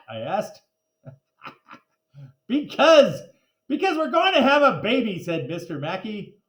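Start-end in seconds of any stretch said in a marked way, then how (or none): 2.5-5.2 high, loud proud reveal; excited announcement. A narrator's reading. Husband proudly reveals the baby, loud and high. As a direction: burst out the happy news.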